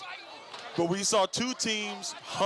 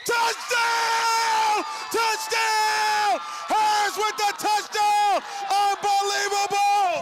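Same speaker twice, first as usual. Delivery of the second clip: screamed, far higher and louder, stretched out; touchdown scream; 14 semitones higher, 5 dB louder, much slower than usual. Real, not acted. Announcer screaming the winning touchdown to listeners, ecstatic. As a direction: let it rip like the game just ended.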